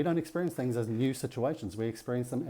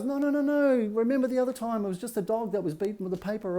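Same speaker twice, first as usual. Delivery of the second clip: high-pitched, hurried insistence, played up; acted frantic reassurance; 10 semitones higher than usual. Intellect arguing away the dog trauma, high and insistent. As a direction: plead with yourself in a raised, high voice. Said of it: "So no matter how much the intellect goes".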